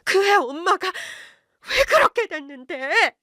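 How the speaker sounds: high, slow outburst from the wife; sudden cry. A narrator's reading. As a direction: blurt it out high and drawn out, stressing 그렇게. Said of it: "갑자기 아내가 소리쳤어요".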